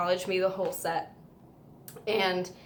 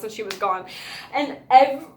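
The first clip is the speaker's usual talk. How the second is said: usual speaking voice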